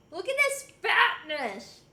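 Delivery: ordinary delivery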